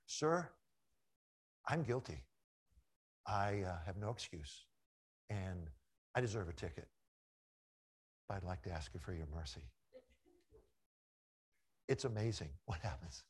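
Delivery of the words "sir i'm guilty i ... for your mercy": softer, humble plea to the officer; humble confession. Retold or imitated. Pastor retelling how he confesses to the officer, quiet and humble. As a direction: confess quietly and ask for mercy. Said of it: "This is what I say".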